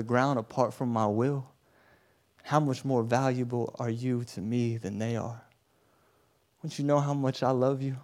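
soft, low, unhurried comfort; Jesus, tenderly reassuring. Acted. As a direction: soothe a frightened child with quiet certainty. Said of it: "he says".

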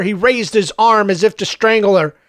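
ordinary delivery

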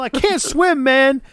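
high whiny mock plea; panicked Ortiz. Ortiz refusing to get in the water: high whiny plea. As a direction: whine it, scared of the water. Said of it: "Ortiz is like".

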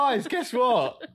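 high, slow announcement to the group; hammed-up big news; imitating someone bursting with news; mock excited discovery. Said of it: "man to discover that was like".

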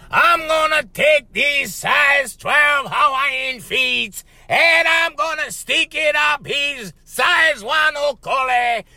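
high, slow shouted wrestler promo; ranting wrestler threat. Retold or imitated. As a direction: roar the threat slowly like a heel on TV. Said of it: "He goes".

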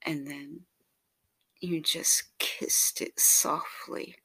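usual speaking voice

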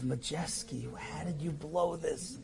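very soft, self-reproaching murmur; quiet self-scolding at the plane window; muttering to himself. Retold or imitated. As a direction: say it under your breath at yourself. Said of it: "saying to myself".

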